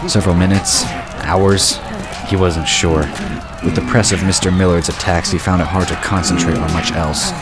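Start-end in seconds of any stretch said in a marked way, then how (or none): none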